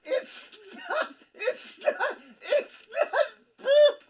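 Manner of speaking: high, squeaky words through tears of laughter; laughing hard. Real, not acted. Cohost repeating a joke through helpless laughter. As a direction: gasp the line out while crying with laughter.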